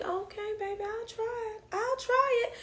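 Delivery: high, soft, meek agreement; giving in. Retold or imitated. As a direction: say it small and high, caving in. Reluctantly giving in to friend.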